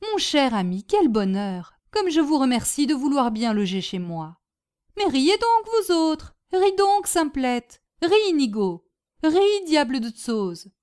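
gushing hostess, bursting into commands; elated Madame Bombec. Acted. Hostess thanking her guest and urging all to laugh, elated. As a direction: share your joy, then urge everyone to join in.

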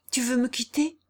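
cling to her as you ask; alarmed outcry. A narrator's reading. Guillaume afraid Jeanne will leave him, alarmed outcry. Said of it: "s'écria Guillaume en lui serrant le bras".

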